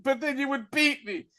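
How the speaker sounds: hammed-up pleading son; mock son voice. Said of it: "he was like".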